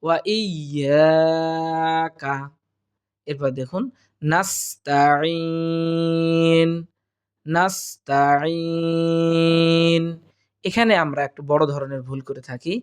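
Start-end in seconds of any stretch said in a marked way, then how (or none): 0.0-2.4 "ওয়া ইয়াকা" very slow, long "yaa", short "ka"; correct tajweed. Shown as a demonstration. Teacher modelling the phrase, careful tajweed. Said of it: "এক আলিফ পরিমাণ টানবো". 4.2-10.0 "নাস্তাইন" slow, thin "taa", long throaty "eeen"; correct tajweed. Shown as a demonstration. Teacher modelling the word, careful tajweed. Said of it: "এইভাবেই".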